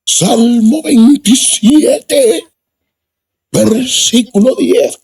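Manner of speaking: high drawn-out pulpit voice; imitating the preacher. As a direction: announce the verse slowly like the village preacher. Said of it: "así hablaba el hombre".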